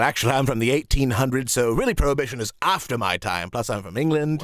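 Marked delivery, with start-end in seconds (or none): none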